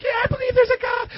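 high-pitched put-on eager answer; eager believer. Imagined believer answering a stranger's question about God, high and eager.

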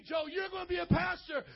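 loud, high-pitched proclamation as God; God's booming command. Acted. God calling Joe to be a pastor, loud commanding shout. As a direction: shout it like a divine order. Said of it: "God told me".

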